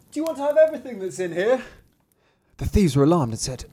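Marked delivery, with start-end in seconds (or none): 0.1-1.6 high squeaky little cry, hurried. Acted. Thumbling calls to the thieves, crying out in a squeak. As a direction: pipe it up in a tiny high voice. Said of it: "cried out with all his might".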